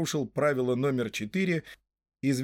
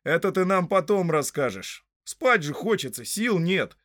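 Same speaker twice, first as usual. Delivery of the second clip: louder, higher, impatient interruption; cutting in; 6 semitones higher, 4 dB louder than usual. A narrator's reading. Pippin interrupts the hobbit to get some sleep, impatient. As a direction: break in firmly, pitch raised. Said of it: "прервал его Пиппин".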